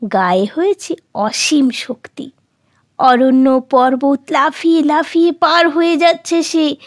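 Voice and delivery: ordinary delivery, nothing marked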